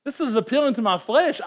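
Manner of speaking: ordinary talk, nothing marked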